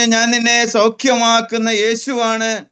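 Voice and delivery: a lightly voiced Jesus raising the sick man. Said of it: "എന്നോട് പറഞ്ഞു". (ഉയർന്ന, പതുക്കെയുള്ള യേശുവിൻ്റെ ആജ്ഞാസ്വരം)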